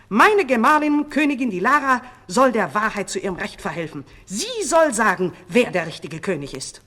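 loud, high-pitched opening, easing in pitch by the end; shouted demand. A narrator's reading. False king calling his queen as witness, shouting. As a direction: demand testimony in a ringing voice. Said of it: "schrie der falsche König".

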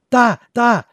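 high, loud, breathless stammer; excited stammer. Acted. Boy pointing out something to grandpa, excited stammer. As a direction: blurt it out, too excited for words. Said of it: "stammelte der Junge".

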